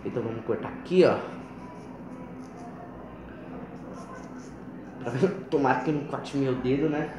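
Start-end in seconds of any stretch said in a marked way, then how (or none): 1.2-5.4 breathy giggle. Real, not acted. Laugh while cutting lemon.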